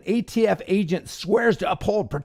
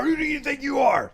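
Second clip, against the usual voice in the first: high, shouted whine, thrice over; mock shouting agent; 9 semitones higher, faster than usual. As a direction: shriek it like a panicking agent. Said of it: "the federal agent's like".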